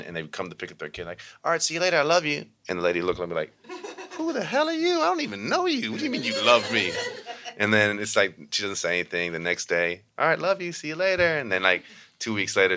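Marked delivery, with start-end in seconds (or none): 1.4-2.4 cheery goodbye. Retold or imitated. 4.2-6.9 high, incredulous, mock-offended; hammed-up who-are-you outrage; suspicious mom. Suspicious new parent voiced. 10.2-11.4 cheery goodbye. Retold or imitated. Cheerful goodbye next day.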